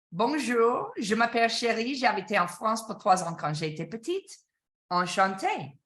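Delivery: speaking French as a demo; a sales trainer showing how French sounds; spoken French. Shown as a demonstration. To sales learners, a short French greeting. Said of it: "in a way that sounds like this".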